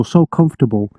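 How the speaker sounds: usual speaking voice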